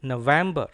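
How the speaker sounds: November with a loud VEM; firm model no-VEM-buh; stresses on NO, VEM. Shown as a demonstration. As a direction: punch VEM in November, light ending. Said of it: "Stress is on N and then V E M".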